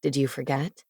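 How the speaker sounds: low, easy male character. A narrator's reading. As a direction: drop low for him. Cade voiced lower in narration.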